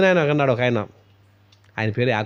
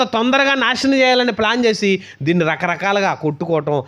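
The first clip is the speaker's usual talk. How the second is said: preaching at full force; 6 semitones higher than usual. Acted. Preacher warning the youth about the devil, forceful sermon. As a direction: thunder it at the congregation.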